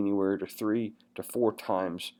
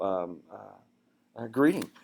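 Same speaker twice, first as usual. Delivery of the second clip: ordinary delivery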